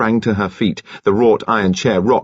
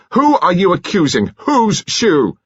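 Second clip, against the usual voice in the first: high, louder furious challenge; outraged demand; 11 semitones higher, 4 dB louder than usual. Acted. Son outraged at the accusation, loud and high. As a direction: fire the questions back in outrage.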